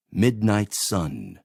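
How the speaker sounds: ordinary talk, nothing marked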